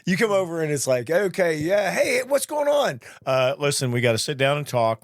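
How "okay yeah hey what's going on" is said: higher, slowing bright mock welcome; overly cheery greeting. Mimicking a buddy who walks in all smiles. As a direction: play the friend who greets you too sweetly.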